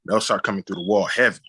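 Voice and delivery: ordinary talk, nothing marked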